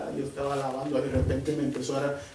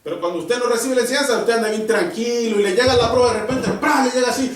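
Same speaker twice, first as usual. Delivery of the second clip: loud, driving pulpit preaching; preaching at full force; 7 semitones higher, 8 dB louder than usual. Acted. Preacher warning the congregation about trials, loud full-force preaching. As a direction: hammer the warning home to the church.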